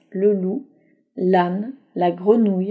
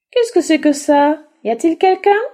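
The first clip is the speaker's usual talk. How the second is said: higher, louder puzzled donkey; curious little donkey; 8 semitones higher, 5 dB louder than usual. Acted. Little donkey asking who is inside, curious.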